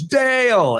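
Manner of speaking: high, ringing call of a name across the field. Retold or imitated. Outfielder running in, hailing the umpire with a high call. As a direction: call the name out like greeting an old friend. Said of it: "He goes".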